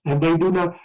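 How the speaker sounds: measured scripture reading voice. Acted. Teacher reading Revelation aloud, measured scripture voice. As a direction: read the verses out to the class.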